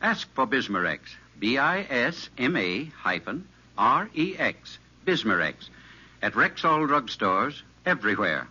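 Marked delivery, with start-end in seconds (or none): none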